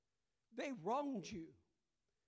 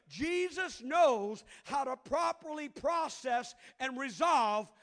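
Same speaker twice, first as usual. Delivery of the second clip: loud, slow, emphatic sermon delivery; full force preaching; 2 semitones higher, 8 dB louder than usual. Real, not acted. Pastor preaching scripture to the congregation, loud and emphatic. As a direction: preach it out to the back row.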